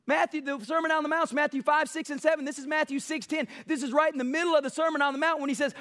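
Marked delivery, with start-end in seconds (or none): none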